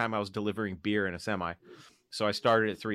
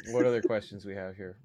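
ordinary delivery

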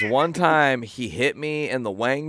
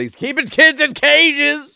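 mock outraged public; 8 dB louder, slower than usual. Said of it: "They're gonna be like".

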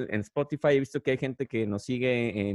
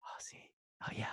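very soft breathy moan; breathy seductive whisper; 18 dB quieter than usual. Pretend. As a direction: sigh it out seductively.

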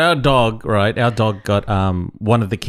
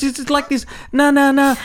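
much higher "na, na, na" buzz; imitating a buzzing noise. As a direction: copy the insect's drone. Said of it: "it sounded like".

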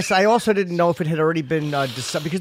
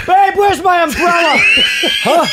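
mock wife shout; 8 semitones higher, 4 dB louder than usual. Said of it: "Hall of Fame".

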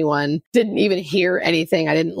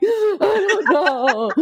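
helpless laugh; 10 semitones higher, much slower than usual. Retold or imitated. Retold helpless reply to sponsor.

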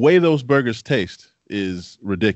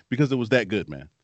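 usual speaking voice

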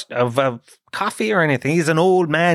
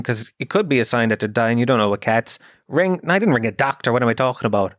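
ordinary talk, nothing marked

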